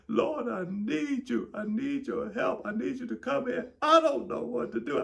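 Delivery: high, loud pleading cry to the Lord; sobbing prayer to the Lord; tearful prayer. Retold or imitated. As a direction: beg God through the tears. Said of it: "I broke down in tears".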